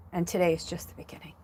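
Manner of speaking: fast whispered secret. Real, not acted. Confiding hopeful message.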